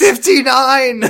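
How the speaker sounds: excited shout. Real, not acted. Shouted answer.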